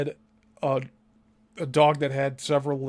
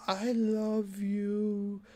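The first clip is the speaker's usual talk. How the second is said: eerie soft; 5 semitones higher, 7 dB quieter, much slower than usual. Retold or imitated. Mocking Satanist spell voice.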